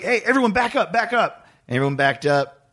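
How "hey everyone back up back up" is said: high-pitched call to step back; imitated crowd command. Singer telling the crowd to back up, high-pitched call. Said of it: "singer directs crowd".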